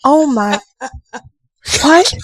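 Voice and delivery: mock-horrified double take; retold shock. Mom retells her shocked reaction.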